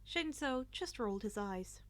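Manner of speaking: ordinary delivery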